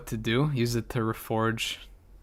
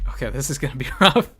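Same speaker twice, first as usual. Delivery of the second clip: louder, quicker with a laugh; 2 semitones higher, 7 dB louder, faster than usual. Real, not acted. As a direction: laugh through the dread. Repeats with a laugh.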